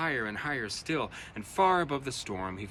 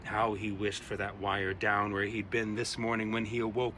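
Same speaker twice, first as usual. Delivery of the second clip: plain reading voice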